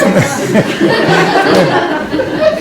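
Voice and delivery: loud breathless laugh; breathy laugh. Real, not acted. Still laughing about Ida's driving, breathless laugh. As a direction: let the laugh run out.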